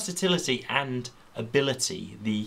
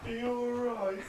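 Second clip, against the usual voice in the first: high mock concern; 13 semitones higher, 5 dB quieter than usual.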